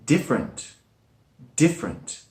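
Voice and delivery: "different" as diff-rent; two-syllable different. Shown as a demonstration. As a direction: drop the middle vowel of "different". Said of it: "it has two syllables".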